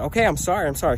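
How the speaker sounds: scrambling to placate, scared; retold panicked apologizing; frightened apology. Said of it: "'cause I'm freaking out".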